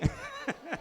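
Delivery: a short, breathy burst of laughter at his own joke; a quick real laugh. Real, not acted. After the balingbing joke.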